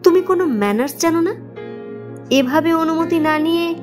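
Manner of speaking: indignant rebuke. Acted. Boss rebukes employee for barging in, indignant. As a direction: demand an explanation, offended.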